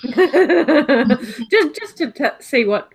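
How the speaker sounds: giggling through the words; laughing. Real, not acted. Laughing while speaking.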